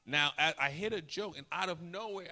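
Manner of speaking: ordinary talk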